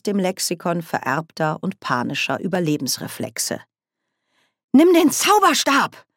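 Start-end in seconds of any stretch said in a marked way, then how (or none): 4.7-6.1 much higher, louder shout. Acted. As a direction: yell the order urgently. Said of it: "rief Nanny".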